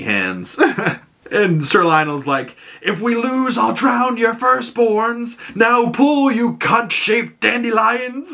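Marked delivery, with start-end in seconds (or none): 2.8-8.3 much higher, slow mock bellow; crude knight. Sir Lionel yelling at Dunk during tug of war, mock bellow. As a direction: ham up a rowdy knight bossing his anchor.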